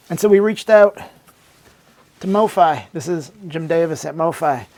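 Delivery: normal talking voice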